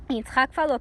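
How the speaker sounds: usual speaking voice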